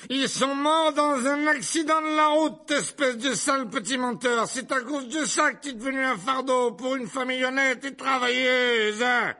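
loud, very high aunt's tirade; furious yelling. A narrator's reading. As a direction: scream the abuse, swelling with anger. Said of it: "hurla la tante Marge en s'enflant de colère". (hurlement de colère, très aigu)

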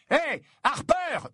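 appel aigu et traînant; l'interpeller de loin; appel crié. A narrator's reading. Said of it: "lui cria Harry". (Harry interpelle Harper en plein vol, appel aigu)

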